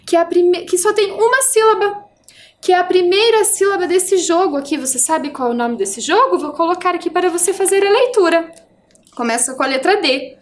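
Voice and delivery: ordinary talk, nothing marked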